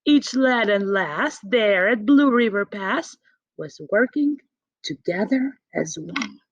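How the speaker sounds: plain reading voice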